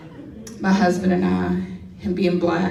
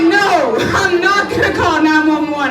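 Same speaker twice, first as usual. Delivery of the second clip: defensive denial; 6 semitones higher, 5 dB louder than usual. Retold or imitated. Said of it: "And I'm like".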